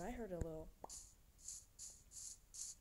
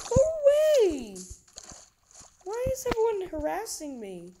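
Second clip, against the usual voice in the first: exasperated yell; 13 semitones higher, 12 dB louder than usual. Real, not acted. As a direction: shout them off in frustration. Mobs harass player.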